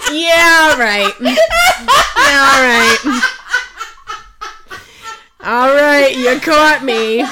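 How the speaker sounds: loud, high, cracking-up laughter through the words; real helpless laughter. Real, not acted. Teased reader admitting her favourite trope, laughing helplessly. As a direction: confess while laughing hard. Laughing confession after friend's teasing.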